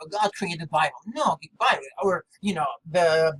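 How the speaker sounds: ordinary delivery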